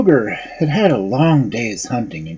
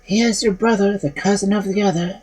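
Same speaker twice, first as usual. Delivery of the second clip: small lamb voice; 8 semitones higher than usual. A narrator's reading. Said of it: "the lamb's flesh answered".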